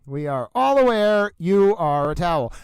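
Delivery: ordinary delivery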